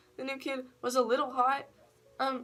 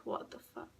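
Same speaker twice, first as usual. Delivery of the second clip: hushed, voiceless reaction; soft, recounted inner outburst; whispered disbelief; 9 dB quieter than usual. Retold or imitated. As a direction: whisper it like a private thought. Recounting silent angry disbelief.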